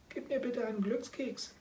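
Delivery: high, meowing little cat voice; kitten voice. Acted. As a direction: ask like a tiny hungry kitten. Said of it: "seine Stimme klang wie das Miauen einer Katze". (hohe miauende Kätzchenstimme)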